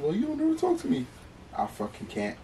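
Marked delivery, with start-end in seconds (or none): 0.0-1.1 much higher, soft mocking whine of a neglected girlfriend; mock whiny girlfriend. Mocking a girlfriend who complains he never calls, high whiny voice. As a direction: pitch it way up and whine like she's complaining. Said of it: "for a girl to complain".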